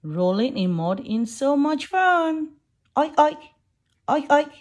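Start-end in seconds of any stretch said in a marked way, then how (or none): 3.0-4.5 loud grunting oinks; pig oink. Acted. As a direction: oink like a muddy piggy. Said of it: "Little piggies hide from the sun".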